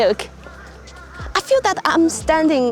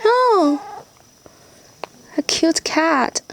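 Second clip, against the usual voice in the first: cooing to a cat; 10 semitones higher, slower than usual. Real, not acted. Talking to a stray cat.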